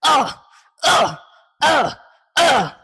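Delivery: loud, high aggressive grunting; aggressive grunts. Shown as a demonstration. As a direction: grunt hard like you're attacking. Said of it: "Add some aggression to the voice".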